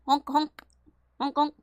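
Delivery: bright playful honking sounds; clown honk. Pretend. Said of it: "Doing a clown style".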